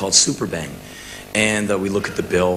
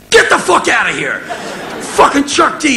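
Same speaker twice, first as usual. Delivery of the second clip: much higher and louder, laughing disbelief; stunned joyful shout; 6 dB louder than usual. Retold or imitated. Seeing [name] hug him, laughing shout. Idol suddenly hugs him.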